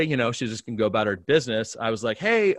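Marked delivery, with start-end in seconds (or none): 2.2-2.6 high, loud, hesitant opener; awkward approach. Retold or imitated. Pastor nervously approaching a guest, awkward. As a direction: launch in awkwardly, pitched up. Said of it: "it's super awkward".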